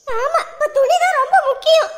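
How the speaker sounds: loud, bitter sarcasm; angry. Acted. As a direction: snap back loudly, upset. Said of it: "ஏண்டி கோவப்படுற?". (கோபமாக, குத்தலாக)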